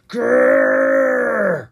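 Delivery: low loud snarling growl; werewolf growl. Acted. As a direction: growl like a beast.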